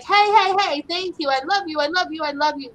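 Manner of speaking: high, loud, eager dog voice; happy dog showering thanks and love; excited big dog. Retold or imitated. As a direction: gush like an overjoyed big dog. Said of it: "this big dog that's like".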